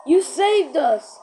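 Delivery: slow, high and loud; high slow cheer. Acted. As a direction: cheer it out slowly. Character thanks.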